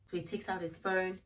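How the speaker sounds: ordinary talk, nothing marked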